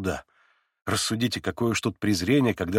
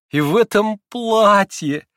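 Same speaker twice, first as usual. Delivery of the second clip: laughing outburst; 11 semitones higher, 8 dB louder, slower than usual. Acted. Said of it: "вырвалось у ней между смехом".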